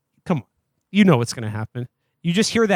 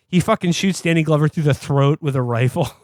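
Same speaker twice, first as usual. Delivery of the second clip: ordinary talk, nothing marked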